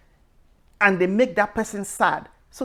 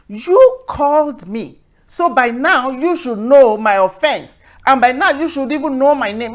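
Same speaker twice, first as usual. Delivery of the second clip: hammered-out retort to a scam caller; indignant retort; 6 semitones higher, 10 dB louder than usual. Retold or imitated. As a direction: push back hard, pressing each point. Confronting scammer on phone.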